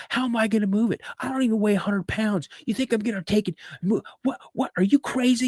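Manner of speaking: high-pitched, indignant mock protest; retold yelling match. Son arguing with his dad over moving the barrel, indignant mock yell. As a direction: imitate a boy arguing back, incredulous. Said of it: "He's yelling at me".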